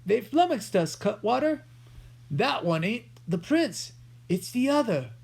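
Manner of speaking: a hint of a rough thief; outlaw. A narrator's reading.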